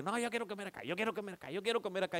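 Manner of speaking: imitando una riña por dónde comer, más agudo; discusión imitada. Retold or imitated. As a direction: remeda a quienes discuten, insistente y agudo. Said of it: "empiezan a, a discutir porque". (discusión fingida, en tono agudo)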